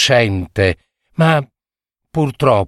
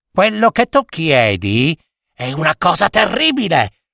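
warning witch; 6 semitones higher than usual. Acted. Pale witch to the fisherman, high and warning. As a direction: warn him like a fearful witch.

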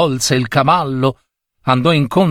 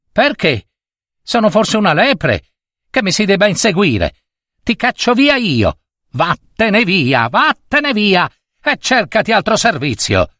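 put-on high-pitched, indignant little boy; acted tantrum in a high voice; mock child's yell; 7 semitones higher than usual. A narrator's reading. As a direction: rant like an enraged child, pitched well up. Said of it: "gridò, ripetendo le ingiurie del nonno".

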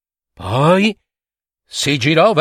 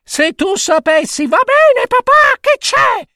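put-on very high child voice; high girlish what is it; impatient little girl. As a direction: cut him off, eager to know. Lucilla impatiently interrupting her father.

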